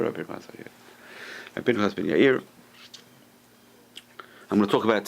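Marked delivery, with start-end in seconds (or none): 0.0-0.7 whispered name. Shown as a demonstration. Teacher whispering the sage's name in awe. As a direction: breathe the name in a hush.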